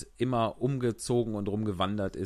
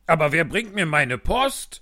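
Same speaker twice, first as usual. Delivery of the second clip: grumbling king; 5 semitones higher, 7 dB louder than usual. Acted. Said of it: "murrte der König".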